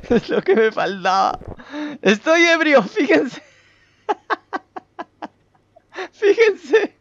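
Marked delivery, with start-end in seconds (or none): 2.0-6.9 laughing. Real, not acted. Character drunk, laughing.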